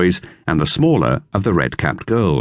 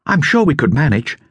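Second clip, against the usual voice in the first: higher, lightly voiced boy; boy's voice, lifted higher; Dick; 8 semitones higher than usual. A narrator's reading. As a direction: read it as young Dick. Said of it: "said Dick".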